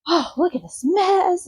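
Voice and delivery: put-on high, fussy old lady tut-tutting; grandmother voice. Grandmother scolding the mess, pitched high. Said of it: "she's just like".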